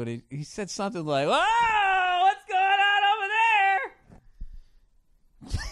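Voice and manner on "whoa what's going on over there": high, sing-song drawn-out sportscaster call; hammed-up announcer cutting in; loud announcer. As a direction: belt it across the bar like a game caller. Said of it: "he just literally goes like".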